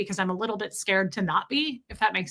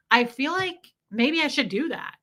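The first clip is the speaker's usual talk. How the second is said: usual speaking voice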